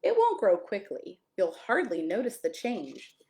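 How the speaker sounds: plain reading voice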